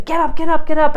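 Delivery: loud, high, exasperated get up; mock yell. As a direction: bark at the kid like a frazzled parent. Stressed parent yelling at child.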